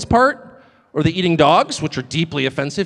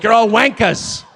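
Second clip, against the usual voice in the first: mock insult; 4 semitones higher, 3 dB louder, slower than usual. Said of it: "Be like".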